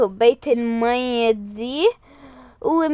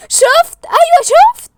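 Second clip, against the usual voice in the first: much higher, louder gotcha; triumphant; 11 dB louder than usual. Real, not acted. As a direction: crow that you were right. Excited vindication.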